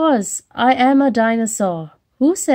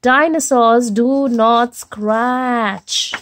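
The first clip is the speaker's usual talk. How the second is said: slow, firm chant; slow stubborn motto; slow emphatic rule; 3 dB louder, slower than usual. Acted. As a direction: recite the rule firmly. Repeating the rule.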